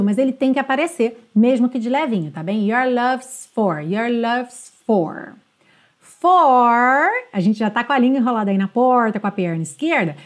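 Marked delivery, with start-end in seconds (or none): 2.7-5.3 "love's" with a light S into "for"; subtle S in love's. Shown as a demonstration. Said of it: "ele é muito sutil". ("love's" com S sutil, de levinho)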